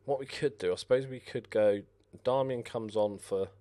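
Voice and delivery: ordinary talk, nothing marked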